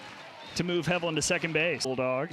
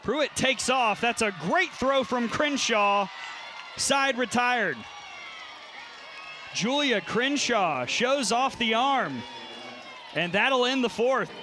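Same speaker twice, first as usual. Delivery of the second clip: excited; 5 semitones higher than usual. Real, not acted. Great throw, excited call.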